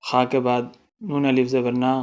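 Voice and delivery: ordinary talk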